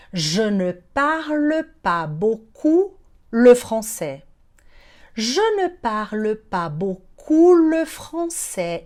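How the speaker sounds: measured, word-by-word model; slow teaching demo. Shown as a demonstration. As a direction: spell it out slowly for learners. Teacher models phrase slowly. (demonstração pausada, bem devagar)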